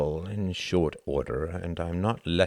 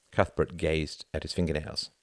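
plain reading voice

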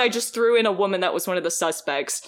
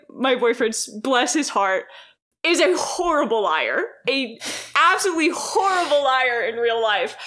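ordinary talk

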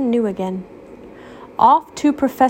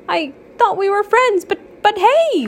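higher flustered stammer; stuttering; 11 semitones higher than usual. Acted. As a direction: stumble over the words, hurt. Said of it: "Waffle stuttered".

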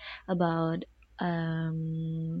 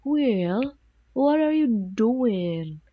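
stretched, gliding Welsh vowels, higher and louder; slow Welsh lilt with swooping pitch; Welsh accent; 4 semitones higher, 5 dB louder, much slower than usual. Shown as a demonstration. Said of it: "they stretch out the vowel, they stress it".

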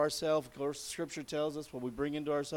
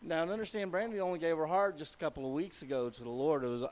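ordinary talk

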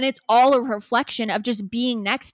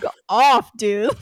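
loud fed-up outburst; real outburst; 8 dB louder, slower than usual. Real, not acted. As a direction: blurt it out, much louder, fed up. Angry at his pet name.

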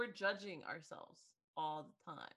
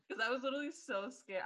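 normal talking voice

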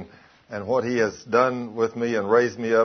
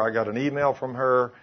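ordinary delivery

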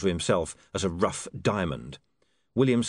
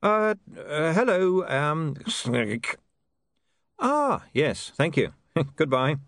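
nervous; 7 semitones higher, much slower than usual. Acted. Flustered greeting to gnoll.

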